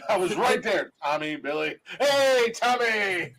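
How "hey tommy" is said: shouted hearty hello; hammed-up loud hello; loud mock greeting. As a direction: greet him like a long-lost buddy. Mock greeting, guessing a name.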